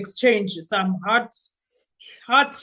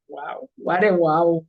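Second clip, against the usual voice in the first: mock wow; 7 semitones lower than usual. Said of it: "I was like".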